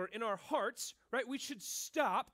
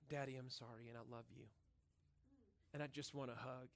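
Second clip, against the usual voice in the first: put-on much softer, lower, tender child's plea; sorry child; 9 semitones lower, 10 dB quieter than usual. Child to angry dad, soft tender apology. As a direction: melt dad's anger with a small apology.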